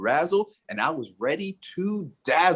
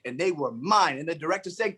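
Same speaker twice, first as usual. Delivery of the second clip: ordinary delivery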